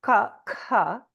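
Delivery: ka, ka as a dry mechanical click; crisp unvoiced k. Shown as a demonstration. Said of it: "is a mechanical sound".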